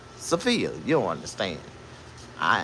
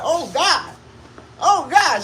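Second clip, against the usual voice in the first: high, louder squealing panic; mock panic; 6 dB louder, slower than usual. Man recalling the night she collapsed, high panicked yelp. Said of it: "I'm like".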